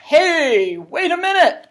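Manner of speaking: high, loud call to stop her; a new bee cuts in; bumblebee calling out. Acted. Bumblebee interrupts Honey.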